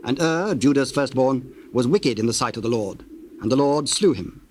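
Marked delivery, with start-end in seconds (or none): none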